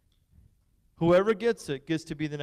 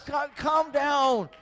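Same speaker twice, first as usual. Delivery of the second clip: slow, higher, drawn-out pretend plea; mock calm down; 8 semitones higher, much slower than usual. Pastor teasing the cheering crowd, slow mock plea. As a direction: stretch it out like fake modesty. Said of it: "When I say come on, that means more".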